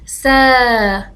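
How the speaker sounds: سا drawn out long and slow; let's repeat together, slowly; slow repeat demo. Shown as a demonstration. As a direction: hold the long a in سا. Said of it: "فلنكرر".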